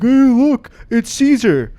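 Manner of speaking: put-on high, loud goofy kid; mock a clueless zoo kid; dumb kid voice.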